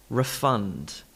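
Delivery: refund as a verb, re-FUND, falling; verb stress on second syllable. Shown as a demonstration. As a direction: reduce the first syllable, punch FUND in refund. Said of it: "refund is the verb".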